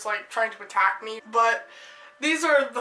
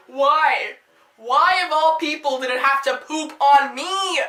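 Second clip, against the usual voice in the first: mock anguished lament; 5 dB louder than usual. Mock lament at bird poop.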